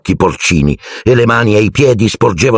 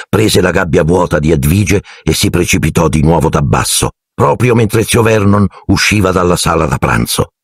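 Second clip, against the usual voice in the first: ordinary delivery, nothing marked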